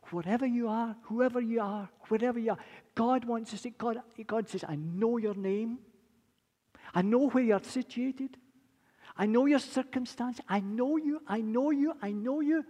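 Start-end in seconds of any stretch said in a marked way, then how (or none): 4.7-12.6 starts gentle, swells to a loud, high proclamation; God's voice rising from tender to full cry; voicing God, building up. Preacher voicing God's words.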